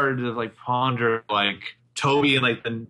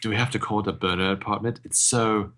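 lightly voiced snooty Toby; a hint of fussy disdain; 2 semitones lower, 4 dB quieter than usual. Toby complaining about the apartment name, lightly snooty. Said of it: "He says".

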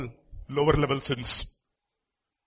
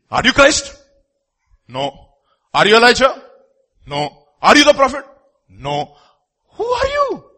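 high, loud demanding questions to John; questioning priests; 9 semitones higher, 7 dB louder than usual. Acted. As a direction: press him like an interrogator.

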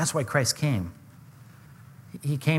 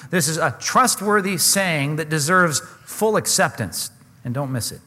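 ordinary talk

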